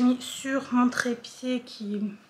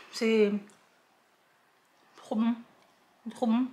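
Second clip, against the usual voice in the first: chewing while talking; talking with a full mouth; 4 dB quieter than usual. Real, not acted. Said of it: "je mange la, la bouche pleine".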